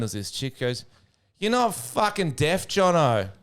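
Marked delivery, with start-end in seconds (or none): 1.4-3.3 high, drawn-out heckle; woman calling out. Retold or imitated. Woman heckles from rows behind, high drawn-out call. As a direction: call it slowly across a crowd. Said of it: "I hear a voice".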